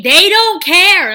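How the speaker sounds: loud, slow, stressed they don't care; emphatic. Retold or imitated. Friend insisting the scammers won't care about her organs, loud and slow. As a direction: hammer the point home slowly. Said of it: "I've got a K".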